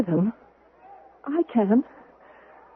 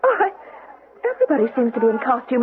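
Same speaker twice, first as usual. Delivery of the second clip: ordinary talk, nothing marked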